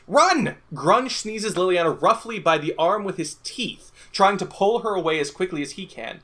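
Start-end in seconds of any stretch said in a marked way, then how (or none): 0.1-0.6 higher, louder urgent cry; narrated shout. A narrator's reading. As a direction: shout the warning to flee.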